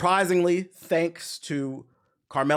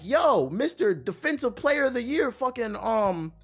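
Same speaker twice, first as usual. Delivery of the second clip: higher-pitched mock yell at a player; exasperated yell at the screen; 8 semitones higher than usual. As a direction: call out the player, half-shouting. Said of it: "I was yelling at the T V".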